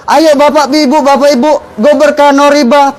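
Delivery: rally the crowd like an event emcee; booming prize announcer. Retold or imitated. Hyping a fertilizer prize event.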